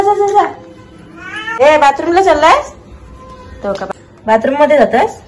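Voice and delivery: high, loud sing-song urging. Real, not acted. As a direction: coax the cat playfully. Shooing the cat to bathroom.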